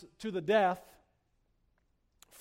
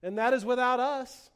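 higher-pitched mock praise; sarcastic; 5 semitones higher than usual. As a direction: say it as if applauding, meaning the opposite. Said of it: "which he doesn't really mean".

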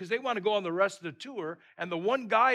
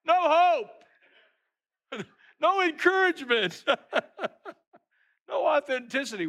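much higher and louder, laughing disbelief; outraged; 11 semitones higher, 9 dB louder than usual. Acted. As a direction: throw it out in incredulous outrage. Mocking the guide's attitude.

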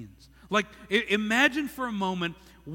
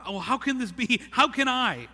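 raised, louder bewildered questioning; completely confused; 5 semitones higher, 4 dB louder than usual. Acted. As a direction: act out a baffled young Mary, pitch jumping up. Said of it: "she's completely confused".

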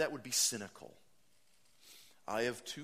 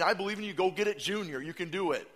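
mock pep talk; 7 semitones higher, 10 dB louder, faster than usual. Mocking shallow encouragement.

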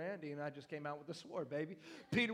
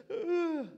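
high, loud mock whimpering groan; a hammed-up pitiful crying ugh; pathetic cry; 13 semitones higher, 10 dB louder than usual. Shown as a demonstration. Preacher acting out the pathetic cry, high mock wail. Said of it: "It's the".

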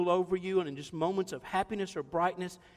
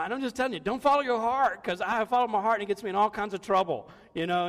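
ordinary talk, nothing marked

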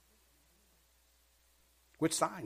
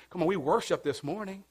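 ordinary talk, nothing marked

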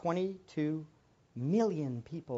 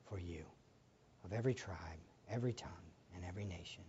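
low, soft prayer voice; hushed pastoral prayer; 5 semitones lower, 4 dB quieter than usual. Real, not acted. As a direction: pray quietly for the people. Leads a prayer.

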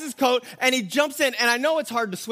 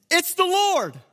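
higher and louder, thrilled; excited; 8 semitones higher, 4 dB louder, slower than usual. Acted. Disciple telling Peter the Lord is here, excited. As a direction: burst out with joy at the news. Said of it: "so I know he's excited".

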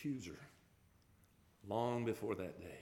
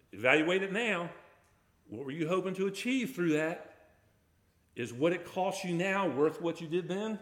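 louder, driving the challenge home; forceful preaching; 5 semitones higher, 6 dB louder than usual. Acted. Preacher challenging his congregation, loud and insistent. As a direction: push each question at them like a demand.

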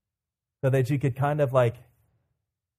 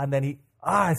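ordinary talk, nothing marked

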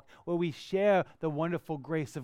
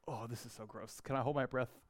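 much softer, lower, held-breath grossed-out mutter; disgusted aside; 6 semitones lower, 12 dB quieter than usual. Retold or imitated. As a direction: hush it like you're holding your nose. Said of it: "I'm like".

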